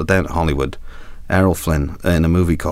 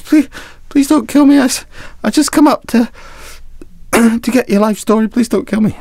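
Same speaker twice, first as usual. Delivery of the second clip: very high, pleading squeak; terrified young reporter. Acted. Young reporter begging the pirate captain not to kill him, high and pleading. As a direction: beg the pirate for your life in a high squeak.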